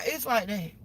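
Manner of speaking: normal talking voice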